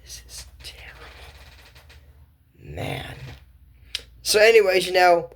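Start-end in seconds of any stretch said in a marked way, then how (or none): 0.0-3.1 fast, unvoiced whisper of dismay. Real, not acted. Judging the rude knight, a whispered verdict. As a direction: hiss it under your breath.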